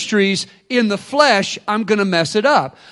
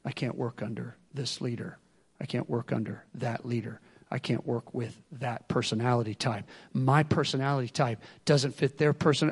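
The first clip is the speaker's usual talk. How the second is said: a quiet mock grumble, lower; mock whining; 8 semitones lower, 11 dB quieter than usual. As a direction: mutter the excuse softly, lower. Voicing complaining church member.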